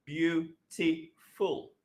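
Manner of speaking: "beautiful" stretched very slowly, syllable by syllable; slow syllable demo. Shown as a demonstration. Teacher slowing "beautiful" so students hear its syllables. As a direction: draw out "Beautiful" so each syllable stands apart. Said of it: "First one is u".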